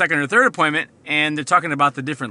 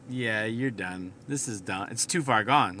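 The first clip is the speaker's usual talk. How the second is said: hushed grim verdict; 6 semitones lower, 8 dB quieter than usual. Pretend. Imagined doctor's grim verdict.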